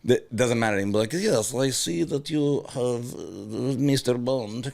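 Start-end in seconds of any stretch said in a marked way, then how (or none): 1.1-4.7 slow mock Russian villain accent. Shown as a demonstration. Villain greeting Mister Bond, slow hammed-up Russian accent. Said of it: "version of Russian".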